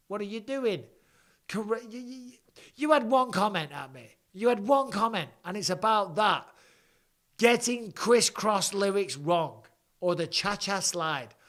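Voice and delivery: mock outrage at a petty comment; exasperated rant. Real, not acted. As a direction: tell the commenter off, pitch rising.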